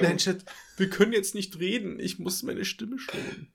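mock voice-saving streamer. Teasing partner about saving voice.

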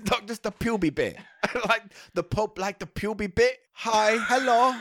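put-on high cod-accented pontiff breaking into laughs; a giggly, wandering silly foreign accent; mock Pope. Pope admitting he likes a bit, high comic accent. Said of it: "I don't know what accent that is".

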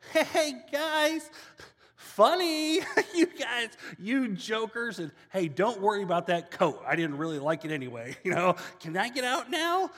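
high, loud, laughing nervous plea; nervous Joseph. Retold or imitated. Joseph begs his brothers from the well, high nervous laughing plea. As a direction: laugh it off, desperate to be let out.

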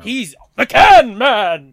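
loud, slow superhero announcement; Avengers-style epic proclamation. Retold or imitated. Storyteller showing how junker announced himself, loud epic proclamation. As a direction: boom it out like a hammed-up movie hero. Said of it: "Avengers Infinity War".

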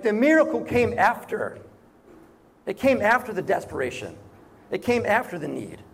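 ordinary talk

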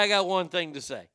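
ordinary delivery

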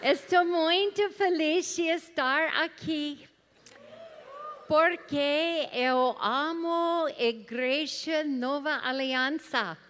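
halting Portuguese with English accent; learner's Portuguese, English accent. Real, not acted. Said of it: "That is my pathetic attempt to speak Portuguese".

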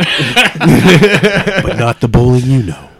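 slow, intense movie trailer narrator; dramatic trailer announcer, drawn out; trailer voiceover. Acted. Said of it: "Can you do it a little slow and more intense?".

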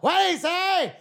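high, slow mock parent explaining the plot; mimicking parents at the movies. Comic explaining the plot to his father in a high mock voice. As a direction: lean over and repeat the line, drawn out.

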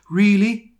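high, loud mock whimper. A narrator's reading. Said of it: "he whimpered".

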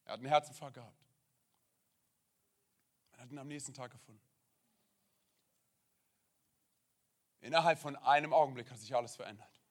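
low, hushed account of a loss; sombre testimony. Real, not acted. (leises, ernstes Zeugnis)

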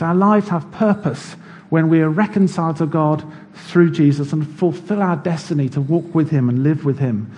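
normal talking voice

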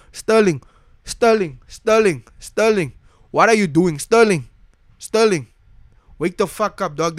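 high, loud repeated call; calling out a player in disbelief, loud; exasperated yelling. Real, not acted. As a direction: yell his name over and over.

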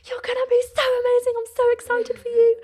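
squealing high and excited for her sibling; excited sister. Retold or imitated. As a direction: gush it like a thrilled big sister. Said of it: "and she was like".

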